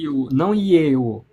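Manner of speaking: "io" against "ieo" said slowly; contrast demo. Shown as a demonstration. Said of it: "a forma mais io". ("io, não ieo" pronunciado devagar, em contraste)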